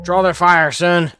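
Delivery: loud, high battle call; shouting orders. Real, not acted. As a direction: bark the order over the gunfire. Calling orders in a firefight.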